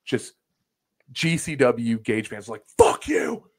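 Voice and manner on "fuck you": imitated high, loud heckle; mad fan yelling. Rival fans at celebrating fans, mad imitated shout. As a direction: hurl abuse like an irate crowd. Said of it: "they're so mad".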